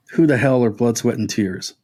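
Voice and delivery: usual speaking voice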